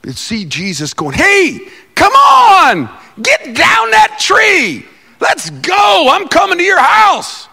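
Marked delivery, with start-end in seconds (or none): 1.2-7.5 high, loud eager holler; excited calling out. Acted. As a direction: call a friend down from a tree. Jesus calls Zacchaeus down from tree.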